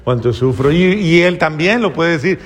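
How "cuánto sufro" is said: husband's mock sigh. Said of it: "él dice".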